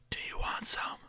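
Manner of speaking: secretive, voiceless whisper; imitated whisper. Classmate secretly offering pills in class, whispered. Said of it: "the amount of whispering that's going on".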